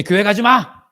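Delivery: high, loud shouted order; angry yell. Acted. Husband forbidding church to a spouse, yelling. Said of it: "막 소리도 지르고".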